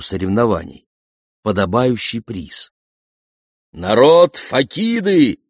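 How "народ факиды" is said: loud, slow royal proclamation; booming cry. A narrator's reading. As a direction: call out to the crowd like a herald. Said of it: "вскричал царь". (громкий торжественный возглас)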